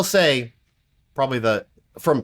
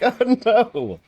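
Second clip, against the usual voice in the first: mock dismay; 11 semitones higher than usual. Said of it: "And I'm always like".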